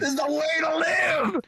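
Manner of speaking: whooped like a party motto; exuberant cry. Pretend. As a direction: cry it out high, stretching the last word. Repeats it as a cry.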